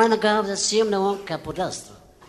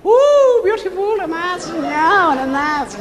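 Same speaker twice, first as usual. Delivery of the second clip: excited delight; 9 semitones higher, 6 dB louder than usual. Real, not acted. Admiring something shown.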